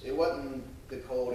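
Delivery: ordinary talk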